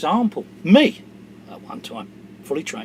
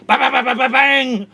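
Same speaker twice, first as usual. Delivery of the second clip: mock gunfire; 5 semitones higher, 10 dB louder than usual. Imitates gunfire.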